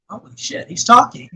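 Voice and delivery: imitating a stunned viewer, hushed then jumping high; mock shock breaking into amusement. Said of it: "would shock some people".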